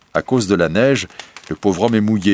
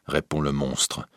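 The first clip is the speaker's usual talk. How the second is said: ordinary delivery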